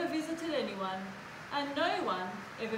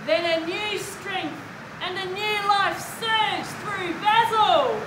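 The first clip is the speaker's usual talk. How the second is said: high, loud, slow storytelling climax; rousing narration; 7 semitones higher, 8 dB louder, slower than usual. A narrator's reading. Storyteller as new life fills Basil, rousing narration. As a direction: build up to a big shout on the name.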